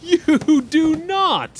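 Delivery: shocked disbelief. Acted. Shocked at friend's racy joke.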